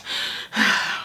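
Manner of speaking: heavy, airy breath out after doing nothing; breathless sigh. Shown as a demonstration. Mum telling viewers she's winded while sitting, heavy sigh. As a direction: show the breathlessness with one long exhale. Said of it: "and you go".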